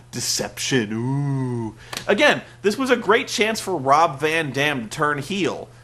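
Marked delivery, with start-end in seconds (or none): 0.1-1.8 much lower, hushed spooky "ooh"; spooky mock villain. Sting spray-painting the belt, hushed spooky mock villain voice. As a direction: croon it like a cheesy mysterious villain. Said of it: "He was all".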